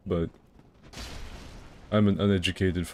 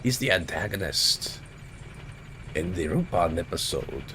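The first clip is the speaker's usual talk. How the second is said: put-on measured, theatrical German-accented villain; hammy German professor voice, in pieces; crazy German professor. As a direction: announce it as a cartoon mad professor. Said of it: "I do that voice".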